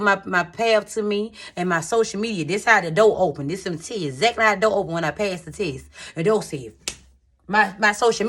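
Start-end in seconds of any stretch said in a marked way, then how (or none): none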